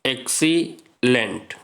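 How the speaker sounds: "Excellent" very slowly, with a clear gap after XC; teacher breaking "excellent" apart for counting; split into syllables. Shown as a demonstration. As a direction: sound out "excellent" as two drawn-out chunks, XC and lent. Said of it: "यहाँ पे देखिए दो syllable हो गया".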